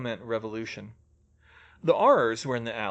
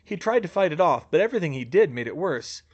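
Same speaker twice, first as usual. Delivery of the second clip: ordinary delivery, nothing marked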